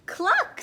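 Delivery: short high cluck; chicken cluck. A narrator's reading. Narrator voicing a chicken, high cluck. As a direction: cluck like a storybook hen. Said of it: "said a chicken".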